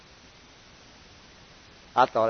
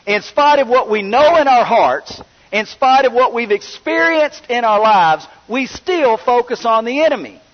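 higher, building sermon appeal; rising preaching; 6 semitones higher than usual. Acted. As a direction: press the point harder to the church.

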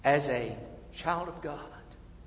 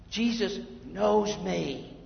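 louder, higher, fervent preacher; preaching at full force; 6 semitones higher, 13 dB louder than usual. Acted. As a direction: drive each line home to the congregation.

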